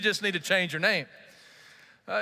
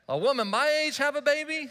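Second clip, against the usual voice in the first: high-pitched incredulous scoff; acted scoff of disbelief; 7 semitones higher than usual. A narrator's reading. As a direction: put on Sarah's voice, scoffing in a higher pitch. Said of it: "she scoffed to herself".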